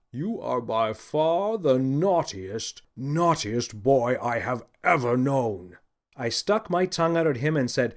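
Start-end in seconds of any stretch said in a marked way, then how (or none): 0.1-5.8 slow, deliberate scolding Santa; stern Santa scolding. A narrator's reading. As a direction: scold the boy slowly as an angry Santa. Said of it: "His cheeks blazed a bright red".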